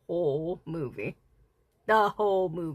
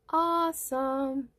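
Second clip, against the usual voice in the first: drawn-out awesome; 5 semitones higher, much slower than usual. Acted. Drawn-out echo of own word.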